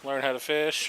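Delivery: ordinary delivery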